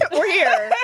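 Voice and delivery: spoken through a laugh; giggling mock entrance line. Real, not acted. Friends recapping the show, laughing through the line.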